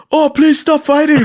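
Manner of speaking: high-pitched mock pleading. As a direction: plead in a put-on, squeaky-high voice.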